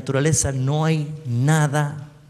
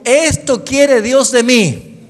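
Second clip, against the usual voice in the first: repetición con la congregación; 9 semitones higher, 8 dB louder, much slower than usual. Shown as a demonstration. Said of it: "Diga conmigo".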